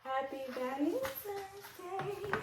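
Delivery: high, slow, sweet put-on voice; cutesy voice. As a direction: coo it like a greeting card. Reads card in cutesy voice.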